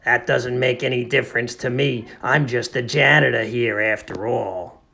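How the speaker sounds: put-on workman voice, unbothered; Milo the janitor. Janitor shrugs off the missing chapter, indifferent.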